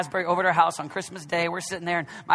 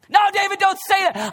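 higher and louder, pleading; mock protesting listener; 12 semitones higher, 7 dB louder than usual. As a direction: object to the preacher. Imagined listener objecting.

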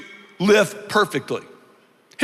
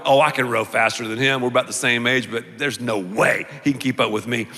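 low, confident aside to himself; inner thought; 9 semitones lower, 4 dB quieter, faster than usual. Retold or imitated. Competitive rower sizing up the next man, low private thought. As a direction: size up a rival privately in a low voice. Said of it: "I'm thinking to myself".

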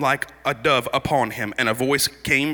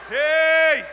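fervent preacher's shout; 12 semitones higher than usual. Real, not acted.

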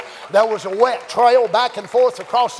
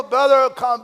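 usual speaking voice

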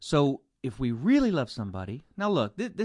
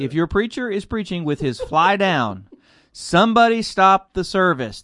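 mock announcement; 5 semitones higher, 9 dB louder than usual. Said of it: "public service announcement".

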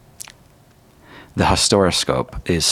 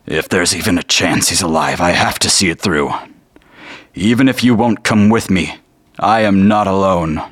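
resolute cry; 4 semitones higher, 5 dB louder than usual. Acted. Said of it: "you hear Emery say".

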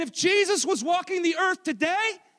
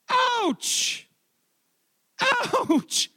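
high, drawn-out yelp; mock-pained ouch; 10 semitones higher than usual. Preacher reacting to Jesus' rebuff, mock-pained high yelp. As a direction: stretch it out high as if stung.

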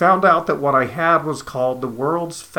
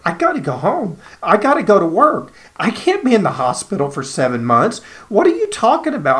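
retold desperate wail, rising higher and louder to a near-shriek; 7 semitones higher, 5 dB louder than usual. Patient pleading with the oncologist about going home, breaking into a rising wail. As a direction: plead in panic, voice climbing out of control. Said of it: "I just wailed".

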